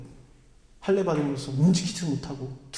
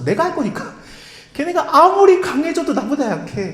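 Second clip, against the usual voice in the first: much higher and louder divine declaration; God's bold promise; 10 semitones higher, 6 dB louder than usual. Acted. God telling Israel he will fight, triumphant loud voice. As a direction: proclaim it like God defying the enemy.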